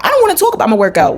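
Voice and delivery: high, fast burst of exasperation; exasperated rebuff. Acted. Gym hater answering people who ask about her workout, exasperated.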